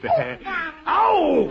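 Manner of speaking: sudden high yelp of pain, ow. Acted. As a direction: cry out as the baby bites. Baby bites his finger.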